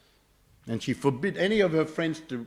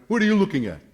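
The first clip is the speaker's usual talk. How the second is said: loud, raised mock-tough challenge; aggressive challenge; 7 semitones higher, 7 dB louder, faster than usual. Gang on the corner to passer-by, mock aggressive. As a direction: play a thug picking a fight.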